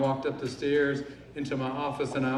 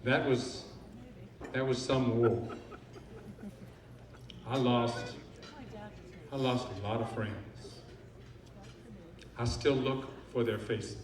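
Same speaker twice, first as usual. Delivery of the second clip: lower, halting, with long pauses; somber remembrance; 3 semitones lower, 7 dB quieter than usual. Acted. War veteran remembering lost friends, somber and subdued. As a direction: sink into quiet grief, understated.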